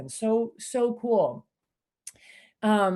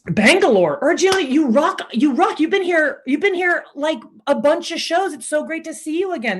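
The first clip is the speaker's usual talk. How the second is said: higher and louder, gushing hello; excited greeting; 6 semitones higher, 6 dB louder than usual. Real, not acted. Host gushing to a returning online viewer, excited.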